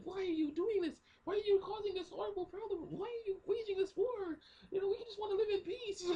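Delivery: very high squeaky falsetto plea; pleading little girl. Retold or imitated. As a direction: imitate a child begging in falsetto. Acting girl pleading against war.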